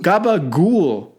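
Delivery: put-on slow, drawn-out Sopranos-style Italian accent; Italian-American accent. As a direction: stretch it out like a Jersey mobster. Mock Italian name reading.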